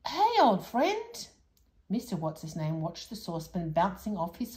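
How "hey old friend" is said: slow, higher, hearty hello; old man greeting his old friend. Acted. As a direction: stretch out a happy greeting. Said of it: "he yelled".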